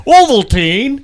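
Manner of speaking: high, loud comedian impression; Seinfeld. Joining the stand-up bit, loud comedian impression. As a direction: belt it like a stand-up punchline. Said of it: "with Seinfeld on there".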